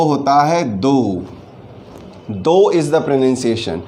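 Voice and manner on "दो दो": दो said slowly, long o, like the Hindi number two; pronounced though. Shown as a demonstration. Teacher modelling though for students, slow and clear. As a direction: model दो as a drawn-out oh sound. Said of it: "pronunciation होता है".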